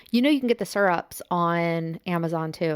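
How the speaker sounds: ordinary talk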